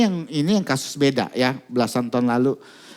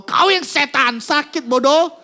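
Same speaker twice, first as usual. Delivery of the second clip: much higher, louder furious retort; angry girl; 12 semitones higher, 5 dB louder, slower than usual. Retold or imitated. Girl insults the men who pinned her down, furious shout. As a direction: hurl the insult back. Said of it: "anak ini ngomong".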